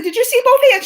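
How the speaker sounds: high, eager question; excited squeal. Retold or imitated. Asking Tessa whether she saw the handshake, eager squeal. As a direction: gush the question at your friend. Excitedly retelling the celebrity encounter.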